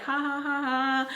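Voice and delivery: spoken high ha-ha-ha; mock giggling without a care; light, put-on laughter; imitated carefree laugh. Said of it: "not a care in the world".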